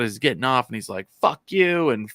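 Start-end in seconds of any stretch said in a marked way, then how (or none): 1.2-1.9 raised-pitch mock outburst; imitated flipping out. Man getting off the bus, ranting curses. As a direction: curse like someone losing it. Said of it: "flipping the fuck out".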